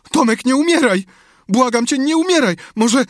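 very high, loud panicked cries; frantic pleading. A narrator's reading. Sid begging his brother to live, frantic. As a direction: beg desperately at the top of the voice. Brother begs him not to die.

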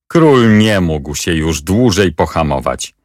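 ordinary delivery

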